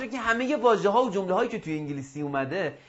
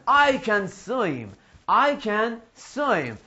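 very slow, drawn-out sentence for repeating; slow drill model; much slower than usual. Shown as a demonstration. Teacher models swimming ability for pupils, very slow drill. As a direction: stretch every word for learners.

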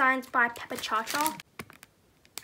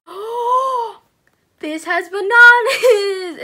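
gasp, then a loud high excited cry; 7 semitones higher, 14 dB louder, much slower than usual. Real, not acted. Reviewer thrilled to find bananas in the packaging, shouting. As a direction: burst out in delight at the find. Discovering banana squishy in unboxing.

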